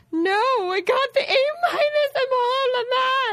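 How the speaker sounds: very high, drawn-out whine; hammed-up crying protest; mock distraught wail. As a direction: wail like a devastated perfectionist kid. Acts out distraught perfectionist child.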